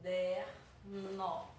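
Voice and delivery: CADERNO very slow and low, split "ca-der-no"; syllable by syllable. Shown as a demonstration. Teacher showing three syllables, caderno slowly broken apart. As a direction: draw out each syllable of "caderno" with gaps between. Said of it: "contêm três sílabas".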